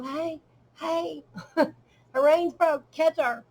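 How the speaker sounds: calling out for help as the horse bolts; retold frantic yell. Mother yelling to catch daughter's runaway horse, strained shout. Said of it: "And I couldn't get it out".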